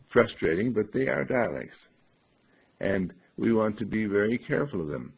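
usual speaking voice

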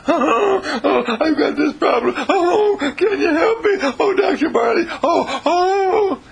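high wailing fake sobs; mock crying. Patient wails to the doctor in mock tears. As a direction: ham up a tearful patient wailing. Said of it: "They cry".

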